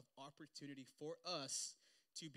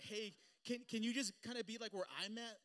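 pleading mock voice; 7 semitones higher, 8 dB louder than usual. Mocking others' plea.

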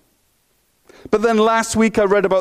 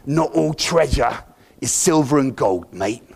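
Jack Sparrow; 5 semitones lower than usual. Retold or imitated. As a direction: growl it like a swaggering pirate captain. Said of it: "this is my best Jack Sparrow voice".

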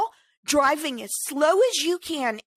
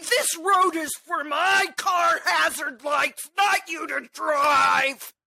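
entitled parker; 2 semitones higher than usual. Retold or imitated. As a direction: drag it out like a spoiled, self-important driver. Imitating entitled hazard-light parker.

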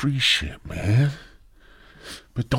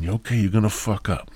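ordinary delivery

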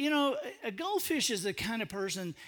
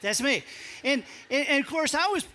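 ordinary talk

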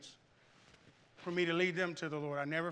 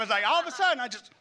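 ordinary talk

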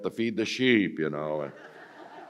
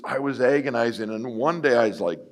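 ordinary delivery

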